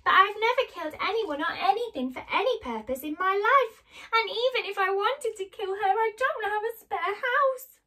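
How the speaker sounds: high, loud wail of protest; tearful outburst. Acted. Dorothy protests the wizard's order to kill, tearfully. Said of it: "Dorothy burst into tears".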